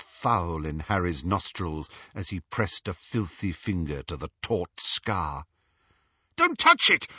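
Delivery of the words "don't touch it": high, loud, urgent outburst; pained yell. A narrator's reading. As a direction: yell it out as if the touch hurts. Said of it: "Harry yelled".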